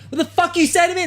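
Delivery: high-pitched mock confrontation; hard-ass comeback. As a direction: imitate a movie hard ass squaring up. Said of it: "like, a fucking hard ass".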